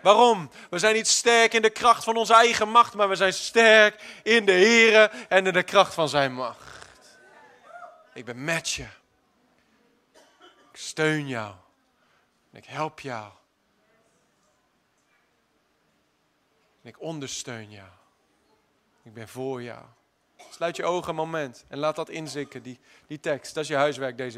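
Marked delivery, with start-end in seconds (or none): 0.0-6.9 loud, slowing proclamation; forceful preaching. Acted. 8.1-19.8 soft low voice of comfort; tender reassurance. Acted. Speaking as God, gently.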